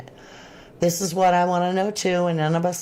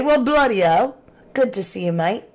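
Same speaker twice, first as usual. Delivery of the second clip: put-on playful British accent, higher and louder on bloody hell; mock British accent; 3 semitones higher, 3 dB louder than usual. Host greeting a Berkshire viewer, hammed-up British accent. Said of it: "'Cause if you say it like that, then aren't you, uh, Australian?".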